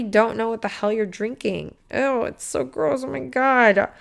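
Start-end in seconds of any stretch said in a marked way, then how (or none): 1.9-3.9 drawn-out grossed-out ew; disgusted. Real, not acted. Vlogger recoiling from tap water, disgusted. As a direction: let the disgust drip off gross.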